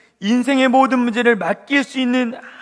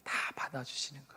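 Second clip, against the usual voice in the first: near-whispered, much softer, fast; hushed; 8 semitones lower, 19 dB quieter, much faster than usual. Real, not acted. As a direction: let it fall to a hush. Tender point about God.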